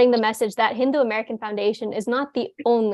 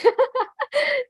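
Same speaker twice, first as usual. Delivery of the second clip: short high-pitched laugh; 14 semitones higher than usual. Real, not acted. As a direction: break into a bright laugh. Laugh after joking toast.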